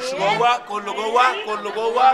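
commanding. Acted. Husband herding family in, loud command. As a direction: push them all inside. Ordering everyone inside.

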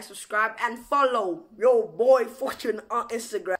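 loud, punchy call to follow; hyped plug. Real, not acted. Vlogger asking viewers to follow on Instagram, loud and hyped. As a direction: belt out the channel plug, slowing down.

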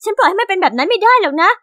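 plain reading voice

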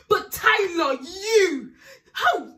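outraged cry, voice breaking; shaking with anger. Real, not acted. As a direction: scold him, trembling with rage. Outraged scolding.

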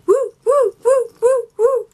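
high, loud cheering woos; excited whoops. Real, not acted. Vlogger cheering herself on, high loud whoops. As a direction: whoop like a fan hyped up.

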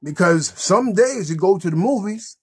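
ordinary talk

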